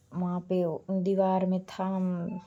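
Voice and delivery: usual speaking voice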